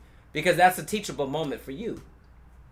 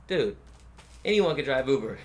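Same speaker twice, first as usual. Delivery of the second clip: ordinary delivery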